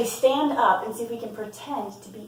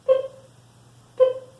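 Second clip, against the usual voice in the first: tiny, very high peep; short squeaky chirps from a newborn duckling; hatchling chick; 10 dB louder than usual. A narrator's reading. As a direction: peep like a just-hatched bird. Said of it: "her new brother ... and said".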